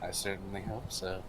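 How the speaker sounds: soft, self-assured parting remark; quiet menace. Acted. Kaz grins and walks away from the dwarf, quietly menacing. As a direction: say it very softly, coolly.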